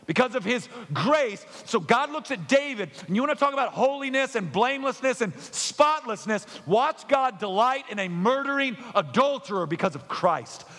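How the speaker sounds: high, loud, driving sermon voice; fiery preaching. Acted. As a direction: preach it out with full force. Preaching at full force.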